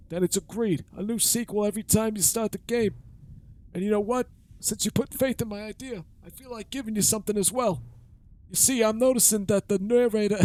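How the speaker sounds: put-on high creaky old man; old man voice, pitched high. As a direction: play a rickety old man. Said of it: "my voice is starting to hurt".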